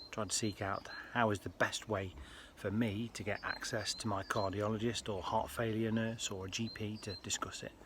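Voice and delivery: ordinary talk, nothing marked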